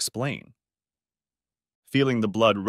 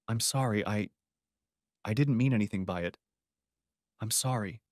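softer, halting sorry; stuttered apology; 6 dB quieter than usual. A narrator's reading. Jason apologizing to Kenny, softly and haltingly. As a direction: stumble over the apology, softer. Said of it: "He stuttered".

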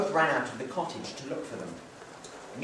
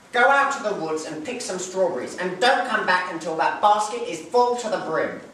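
scolding mother; 3 semitones higher, 6 dB louder than usual. Acted. Mother sends children to woods.

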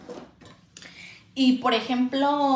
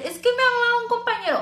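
imitating son; 12 semitones higher, 5 dB louder, faster than usual. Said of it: "A mí siempre Iker".

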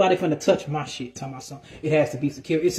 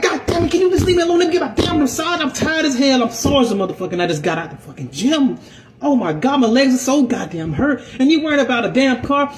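higher, louder exasperated rant; mock angry rant; 8 semitones higher, 3 dB louder than usual. Sore gym-goer ranting at Karen to leave him alone, loud and high. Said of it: "I'm like".

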